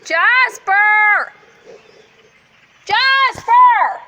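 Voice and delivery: loud, high call across the yard; calling a dog. Real, not acted. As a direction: shout the dog's name.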